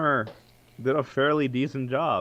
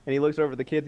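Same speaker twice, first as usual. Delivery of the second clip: usual speaking voice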